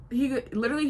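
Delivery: ordinary delivery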